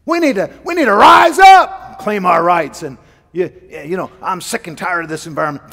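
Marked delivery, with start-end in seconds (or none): 0.0-2.8 shouted, higher, hammed-up agitator; mock rallying shout. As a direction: yell it like a fired-up union man.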